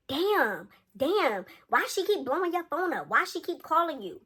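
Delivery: high, sing-out complaint from the background; mock other woman. Imitates girl in background of call.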